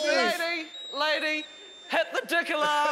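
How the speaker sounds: calling out. Acted. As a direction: shout after a departing customer. Calling out to fleeing shopper.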